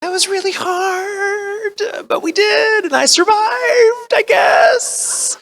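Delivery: frightened at revisiting the role; mock scared wail. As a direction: sob it out in hammed-up dread. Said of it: "it scared me".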